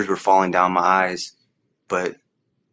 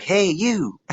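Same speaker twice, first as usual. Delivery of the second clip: put-on high, bright old-lady call, falling at the end; a light imitation of an old woman hailing a boy; 11 semitones higher than usual. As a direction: call out like the elderly neighbour spotting him. Old lady calls out to him.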